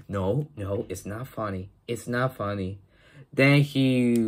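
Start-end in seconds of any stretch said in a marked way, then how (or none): 0.1-2.8 quiet protest. Retold or imitated. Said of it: "He's like".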